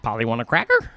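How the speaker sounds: squawky talking parrot. Pretend. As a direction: do a parrot saying it. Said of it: "You like my parrot voice?".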